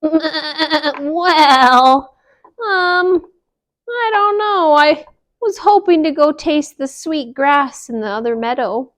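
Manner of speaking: high wavering sheep; high sheep with bleats; bleating sheep voice. Acted. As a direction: baa through the words. Sheep speaks.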